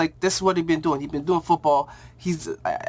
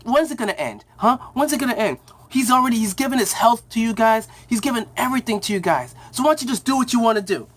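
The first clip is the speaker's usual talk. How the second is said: high, exasperated imitation of pleading; retold indignant protest; 6 semitones higher than usual. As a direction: challenge the dean with rising exasperation, pitched up. Brother confronts dean, retold.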